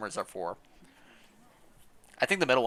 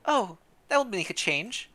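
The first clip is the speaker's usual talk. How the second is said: usual speaking voice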